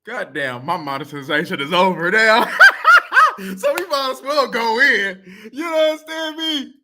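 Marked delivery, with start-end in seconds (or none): 2.5-3.5 high, loud burst of laughter. Real, not acted. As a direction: crack up out loud at your own trouble. Laughs at own lost monetization.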